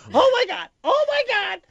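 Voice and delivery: shrill high-pitched panicked squeal; high scream like a girl. Shown as a demonstration. Telling cohosts about spilled coffee, high girlish scream. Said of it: "Like that".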